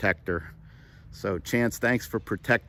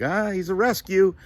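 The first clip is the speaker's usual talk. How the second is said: higher, offhand brush-off; dismissive; 9 semitones higher than usual. Retold or imitated. Owner answering people about his rescue dog, dismissive. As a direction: wave it away lightly on a high 'ah'. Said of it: "like, to dismiss him a little bit".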